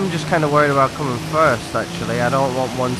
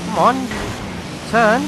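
excited urging; 10 semitones higher, slower than usual. Real, not acted. Gamer yelling at his car to turn, excited urging. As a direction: come on, will it to turn.

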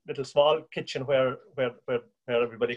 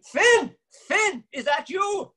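much higher, louder shouted name; shouting; 4 dB louder, much slower than usual. Retold or imitated. Danny shouting to Finn on the phone, much higher. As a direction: call out across the miles. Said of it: "he shouted again".

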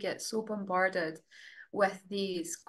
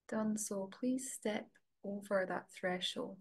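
soft, level voice with long pauses; guided meditation; 5 dB quieter than usual. Real, not acted. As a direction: gently guide them inward, leaving space to breathe.

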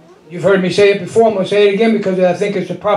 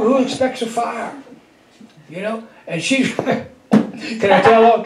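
ordinary delivery

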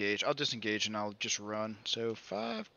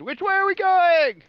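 yelling; 11 dB louder than usual. Acted. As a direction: shout it over the wind. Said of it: "I'm gonna yell to Sazed".

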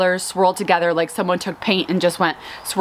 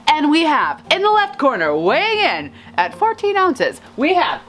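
big high-pitched boxing announcer intro; ring announcer; 8 semitones higher than usual. Acted. Florist introducing tulips like fighters, ring announcer. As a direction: announce a prizefighter weighing in.

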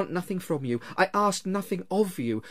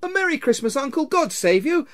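high, loud, cheery greeting; cheerful cry; 7 dB louder than usual. A narrator's reading. Nephew greeting his uncle at Christmas, cheerful cry. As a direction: call out merrily, high and bright. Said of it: "cried a cheerful voice".

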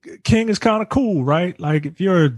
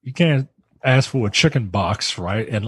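ordinary delivery

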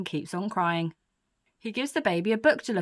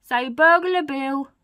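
slow, loud, raised prompt; coaching a baby; 6 semitones higher, 7 dB louder, slower than usual. Acted. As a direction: say it slowly for a baby to copy. Burglar coaches baby to talk.

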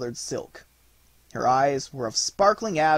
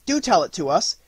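loud, higher, urging voice; eager cry; 6 semitones higher, 9 dB louder than usual. A narrator's reading. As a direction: clamour like children wanting a story. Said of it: "cried the children".

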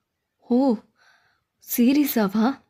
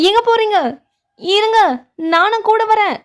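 high-pitched urgent call after someone; 7 semitones higher, 3 dB louder than usual. Acted. Calling after departing master. (அவசரமாக உயர்ந்த குரலில் கூப்பிடுவது)

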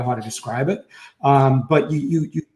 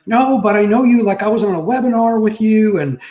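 eager fan; 6 semitones higher than usual. Retold or imitated. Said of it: "And they go".

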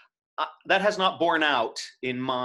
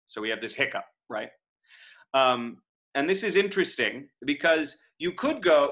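ordinary delivery

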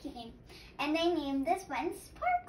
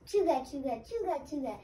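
ordinary delivery

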